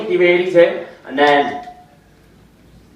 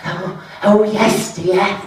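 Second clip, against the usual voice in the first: put-on high, wavering old-lady reply; old woman; 8 semitones higher, slower than usual. Frail old woman with her loaf answering, high and quavery. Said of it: "She's like".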